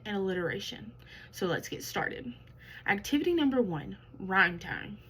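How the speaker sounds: ordinary talk, nothing marked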